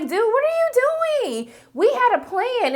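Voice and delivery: high, slow, exasperated scolding; mock-shrill scolding of a partner; exasperated boss. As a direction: drag it out in disbelief. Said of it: "he was just like".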